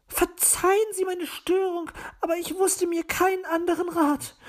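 high-pitched apologetic lady magpie; high, anxious magpie voice; troubled magpie. A narrator's reading.

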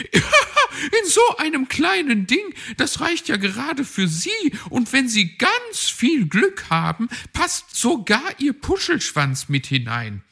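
high, teasing magpie voice; mock the fox's fluffy tail playfully; Frau Elster the magpie. A narrator's reading. Magpie teasing Herr Fuchs about the small bob, high and cheeky.

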